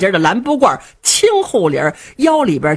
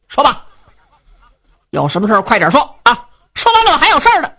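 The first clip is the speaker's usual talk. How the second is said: huffy, hurried, rising higher; impatient young man; 5 semitones higher, 3 dB louder, faster than usual. Acted. Son impatiently brushing off his old father, huffy and hurried. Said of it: "这年轻人气哼哼的".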